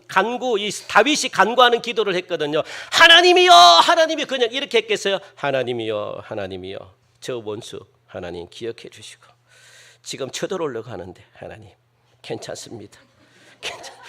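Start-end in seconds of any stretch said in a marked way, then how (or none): 2.9-4.2 loud, high call to God; loud pleading invocation; acted crying out. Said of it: "다윗이 이렇게 기도했겠습니까". 5.3-13.9 soft, low, unhurried murmur; half-hearted hushed invocation; mock quiet prayer. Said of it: "다윗이 이렇게 기도했겠습니까".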